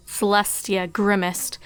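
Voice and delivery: ordinary delivery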